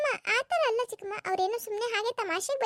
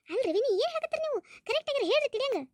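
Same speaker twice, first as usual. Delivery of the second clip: higher, insistent relative's voice pressing for an answer; demanding relative; 6 semitones higher than usual. Acted. Relative pressing the sister-in-law to explain, high and insistent. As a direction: press her to explain, pitched up.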